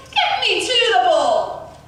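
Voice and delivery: loud, high, drawn-out shout; bossy, stretched-out yell; shouted demand. A narrator's reading. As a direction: shout it slowly as an order.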